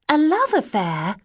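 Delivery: a high, slow, startled echo of the words; indignant cry. A narrator's reading. Bella echoing the agency woman's question, startled and indignant. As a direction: throw the phrase back in disbelief. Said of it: "cried Bella".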